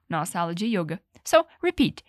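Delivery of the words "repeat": ordinary delivery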